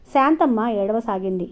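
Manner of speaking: plain reading voice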